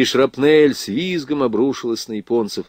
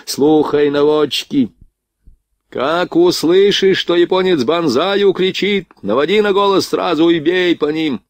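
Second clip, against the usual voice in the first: commanding call; 6 semitones higher than usual. A narrator's reading. Gunner tells the layers where to aim, commanding call. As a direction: call to the whole crew, pitched up. Instructing gun layers in battle.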